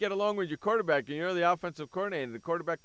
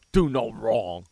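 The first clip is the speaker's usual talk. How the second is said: louder, lower scornful repeat of his words; loud scornful jab; 7 semitones lower, 6 dB louder, slower than usual. Real, not acted. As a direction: spit his slogan back with contempt. How do you get away with.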